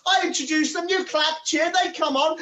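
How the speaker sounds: usual speaking voice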